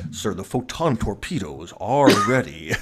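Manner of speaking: low Worf parody, stressing odd words; solemn mock-Klingon officer with misplaced emphasis; Michael Dorn. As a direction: lean hard and long on "are". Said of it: "stresses the wrong words in every sentence".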